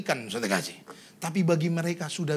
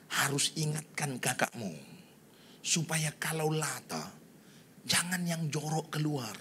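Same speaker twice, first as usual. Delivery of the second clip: soft, slow, careful advice; retold soft, measured reproof; gentle admonishing; 8 dB quieter, slower than usual. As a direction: counsel a friend quietly and slowly.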